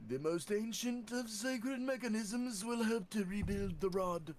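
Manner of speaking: high, strained and wheezing; wheezy voice. Acted. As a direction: wheeze out every word like an asthmatic. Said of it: "do a wheezy voice".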